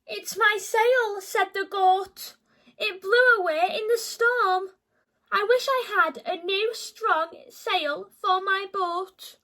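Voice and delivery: mild bleating complaint; woeful goat. Acted. Goat laments, bleating loudly.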